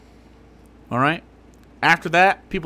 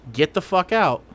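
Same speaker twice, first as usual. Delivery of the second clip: ordinary talk, nothing marked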